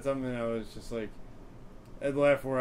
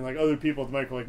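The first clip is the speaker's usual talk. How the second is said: usual speaking voice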